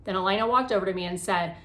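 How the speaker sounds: ordinary delivery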